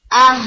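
آہ with a glottal start and breathy ساکن ہ at the end; ساکن ہا کی مشق; sukun demo. Shown as a demonstration. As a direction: stop on the ہ, just breath. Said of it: "ہا کو ساکن کر دیا".

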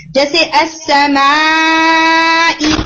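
slow drawn-out अस्समाई, hamza softened away; eased hamza. Shown as a demonstration. Teacher demoing the wrong eased hamza to students. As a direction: glide through अस्समाई without hitting the hamza. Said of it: "उसे सहल कर देते हैं".

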